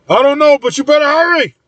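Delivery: high, sustained urgent holler; high-pitched urgent call; shouting. A narrator's reading. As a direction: shout back high, urging a rescuer on. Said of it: "Corey shouts as the hybrid comes closer".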